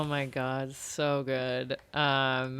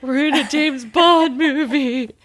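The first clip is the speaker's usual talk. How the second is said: higher, louder, slow and dreamy; dreamy movie-star line; 10 semitones higher, 11 dB louder than usual. Retold or imitated. Tanya on the Vespa, gushing loud and slow about Bond. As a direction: play it like a Bond girl. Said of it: "she's like".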